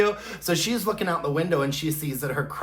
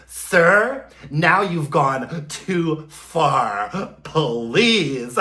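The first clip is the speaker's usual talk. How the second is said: mock outrage; 4 dB louder, much slower than usual. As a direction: act hammily affronted, stretching each word. Acts Carrie's mock outrage.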